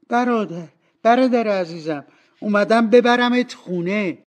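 high, loud, delighted greeting; eager child's voice, bursting with affection; excited little girl. A narrator's reading. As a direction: call to a beloved brother with joy. Narrator voices little sister greeting brother.

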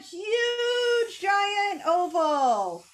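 "Huge" high, then "giant oval" slow; huge stretched. Shown as a demonstration. Teacher to kids, drawing the head with a slow, stretched "Huge". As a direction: draw out the size.

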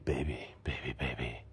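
deep, whispered seducer; very hushed mock seduction. Shown as a demonstration. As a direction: murmur low like a smooth lover. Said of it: "I was just saying".

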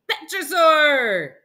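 loud, high, drawn-out exclamation; excited reveal. Acted. Boy announcing the flying dinosaur, excited shout. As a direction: shout the name slowly with amazement.

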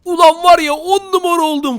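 high-pitched, elated bragging; excited boast. Real, not acted. Player crowing over his new armour, excited boast. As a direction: crow about it with lifted voice.

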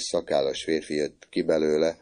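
usual speaking voice

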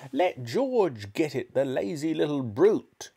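put-on high, drawn-out scornful old woman; shouting Grandma. A narrator's reading. Grandma scolding her grandson George, slow high-pitched shout. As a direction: drag out the scornful order. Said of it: "Grandma shouted".

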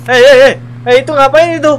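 startled shouting at a stranger on the camera. Real, not acted. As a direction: yell in sudden alarm at the stranger.

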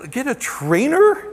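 high, slow question with a big rise; retold incredulous echo. Patient repeats the doctor's advice, incredulous. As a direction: echo it back in mock disbelief.

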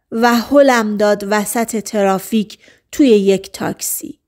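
ordinary delivery, nothing marked

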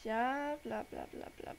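normal talking voice

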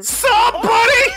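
alarm shout. Real, not acted. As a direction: yell out for help. Calling for help when attacked.

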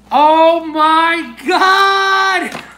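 loud, high, drawn-out cry of delight; excited exclamation. Real, not acted. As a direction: stretch every word out, thrilled. Delighted reaction to opening gift.

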